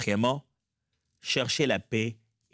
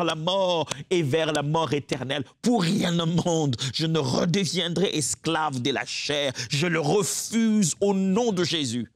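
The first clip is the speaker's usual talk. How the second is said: preaching at full force; 4 semitones higher than usual. Acted. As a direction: hammer out each pour rien au monde. Declaring in prayer.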